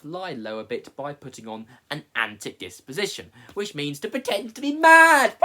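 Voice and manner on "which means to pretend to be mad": bursting into laughter mid-sentence; laughing. Real, not acted. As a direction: laugh through the words. Laughing while explaining madness.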